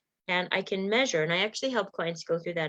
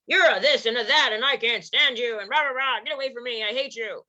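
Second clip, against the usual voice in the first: mock angry rant; 3 semitones higher than usual. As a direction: rattle off pretend rage, easing off toward the end. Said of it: "Same thing with being angry".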